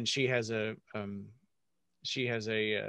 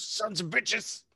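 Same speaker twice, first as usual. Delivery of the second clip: dying curse; 8 semitones higher, slower than usual. Acted. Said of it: "he goes".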